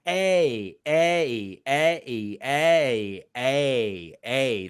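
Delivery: "a" drawn as eh gliding into ee; long A sound. Shown as a demonstration. Teacher putting E and I together, long A sound. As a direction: repeat "a" as a long ay glide. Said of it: "how you get the long A sound".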